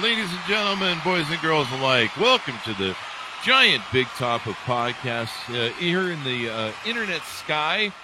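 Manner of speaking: hammed-up ringmaster announcement; ringmaster welcome. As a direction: welcome the crowd under the big top. Host plays circus ringmaster.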